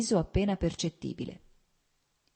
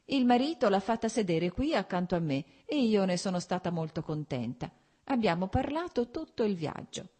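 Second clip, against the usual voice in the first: lightly voiced countess, slipping into French; a hint of an arch old lady's teasing; mother teasing her son about his love affair; 5 semitones higher than usual.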